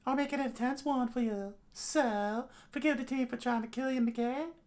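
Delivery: very high character voice; high-pitched, coaxing figure; flirty drinking companion. Acted. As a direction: sweet-talk in a put-on high voice. Plays Himeno in story.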